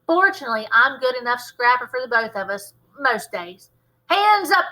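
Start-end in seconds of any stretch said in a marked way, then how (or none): 4.1-4.7 loud high shout. Acted. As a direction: shout the command. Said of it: "I shouted".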